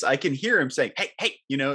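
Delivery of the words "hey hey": imitated actor's call, pitched up; Ray Liotta. Listener imitates the actor calling the popcorn worker, distinctive voice. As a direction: call out in a higher, distinctive star's voice. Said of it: "Ray has such a distinctive voice".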